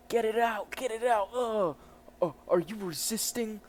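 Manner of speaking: high, loud cry of disgust; hammed-up agitated cop; acted frantic yell. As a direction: shout it as if in a scuffle. Cop yells during acted scuffle.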